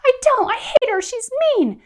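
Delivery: put-on very high, loud child's shriek; a kid screaming back in anger; mock child tantrum, high and loud; upset child yelling. Upset kid yelling about mom.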